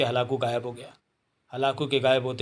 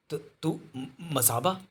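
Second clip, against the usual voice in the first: softer, halting surprised question; stunned stammer; 2 semitones higher, 4 dB quieter, slower than usual. Acted. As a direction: stumble over the words in disbelief. Kobi stunned by Masaba's arrival.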